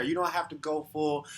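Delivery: normal talking voice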